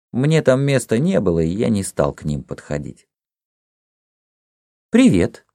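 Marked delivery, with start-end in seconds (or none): 4.9-5.5 lightly voiced girl, higher and louder; a hint of a girl saying hello, pitched up; girl's greeting. A narrator's reading. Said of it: "сказала мне какая-то девушка".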